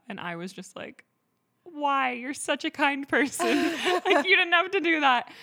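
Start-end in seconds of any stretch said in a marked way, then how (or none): 1.6-5.3 high, loud, laughing gush of gratitude; delighted, laughing disbelief at a stranger's kindness; gushing thanks. Retold or imitated. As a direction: squeal your thanks through a laugh. Thanking a kind stranger, retold.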